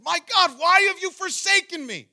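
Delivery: high, full-voiced anguished shout; very loud, high cry of abandonment; crying out in horror. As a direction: cry out to God at full force. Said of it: "he's crying out in horror".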